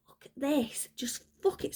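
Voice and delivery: fed-up mutter. Retold or imitated. Voicing fed-up air signs.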